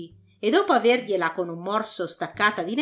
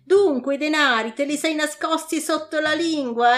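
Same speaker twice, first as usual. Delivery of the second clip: loud, raised angry bandit; 7 semitones higher, 3 dB louder than usual. Acted. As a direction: snarl at the little cheat. Robbers catch him hiding coins.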